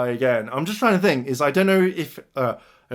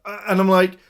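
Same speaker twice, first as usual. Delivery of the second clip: ordinary talk, nothing marked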